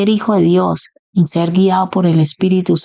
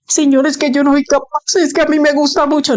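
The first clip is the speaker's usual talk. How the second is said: high, quick whining objection; much higher mock-petulant plea; whiny protest; 9 semitones higher than usual. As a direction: complain to God like a stubborn child. Believer protesting to God.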